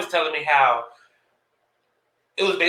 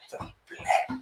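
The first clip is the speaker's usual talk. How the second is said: hushed, voiceless whisper; mock-secretive whisper; whispered imitation; 11 dB quieter than usual. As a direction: whisper it like a forbidden word. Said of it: "she whispers it".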